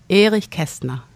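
"Erich Kästner" said as in German; native German pronunciation. Real, not acted. Guest pronouncing the author's name for the host, native German pronunciation. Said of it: "You say it so beautifully".

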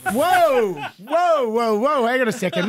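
startled whoa. Retold or imitated. Dad stops daughter's big kiss.

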